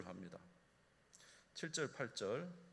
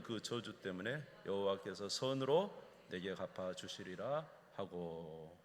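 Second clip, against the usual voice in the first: louder, slow solemn reading of the verses; slow, raised solemn Bible reading; scripture reading; 5 dB louder than usual. Acted. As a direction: proclaim the king's words from the pulpit. Reads David's reply from scripture.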